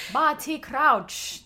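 slow, high, loud character imitation; Barty Crouch. Showing a friend the Harry Potter line, horrible drawn-out impersonation. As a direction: drag the name out, badly faked impersonation. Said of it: "That was a horrible impersonation".

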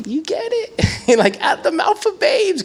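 very high squeaky delight; delighted squeal. Real, not acted. Pastor delighting in his kids' question, very high squeal. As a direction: squeal it out, tickled pink. Delighted aside about kids.

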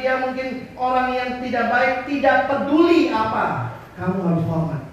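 forceful sermon cadence; preaching emphasis. Acted. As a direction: press every clause home from the pulpit. Verse speaking in preaching voice.

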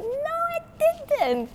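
very high, drawn-out mock protest; emphatic denial. Attendant denying customer's accusation, squeaky and indignant.

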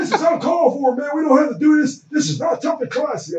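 imitating the coach. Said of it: "You got all these voices".

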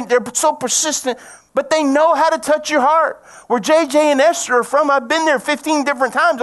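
high, loud, fervent sermon; full-force preaching. Real, not acted. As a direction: preach hard, climbing in pitch and volume.

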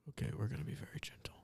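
very soft, quick whisper; ASMR whisper. Pretend. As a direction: whisper gently for an ASMR take. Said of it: "let's A S M R me opening".

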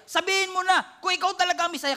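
fast, high and loud push; a hint of the pushy high priest; pushy demand. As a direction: hurry him to confess. Preacher paraphrasing priest's demand.

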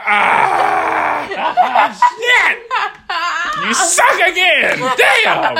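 mock yell. Retold cursing himself after fart.

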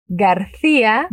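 ordinary delivery

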